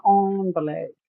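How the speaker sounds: envelope said as "ON-velope"; the fancy French-style "ON-velope" for envelope; fancy pronunciation. Shown as a demonstration. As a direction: start "envelope" with an AHN sound. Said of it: "I don't say envelope".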